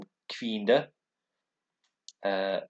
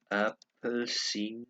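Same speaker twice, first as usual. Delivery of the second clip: Appelsinen drawn out syllable by syllable; careful, slow pronunciation demo; slow sounding-out; slower than usual. Shown as a demonstration. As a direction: stretch the word for learners. Sounding out a Danish word.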